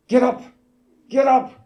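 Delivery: high, loud urgent wake-up call; mother waking her sons as a car pulls alongside; urgent command. Retold or imitated. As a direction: rouse the kids fast, alarmed. Mother waking boys in danger.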